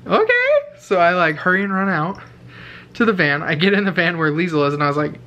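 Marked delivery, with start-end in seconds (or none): 0.1-0.8 mock meek okay. Timid reply to janitor.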